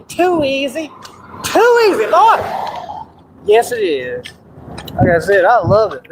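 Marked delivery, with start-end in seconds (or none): 0.1-0.9 restrained catchphrase. Acted. Catchphrase said, then repeated bigger. 1.4-2.4 very high "too easy"; exclaimed catchphrase. Acted.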